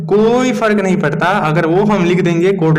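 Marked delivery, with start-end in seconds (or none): none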